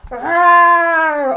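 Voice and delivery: loud growling bear; loud storybook bear growl. A narrator's reading. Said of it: "Old Bear growled".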